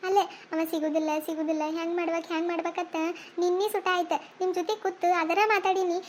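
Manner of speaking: ordinary talk, nothing marked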